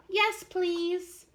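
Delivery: put-on polite eager child; small child. Chester asking for the story, high childlike voice.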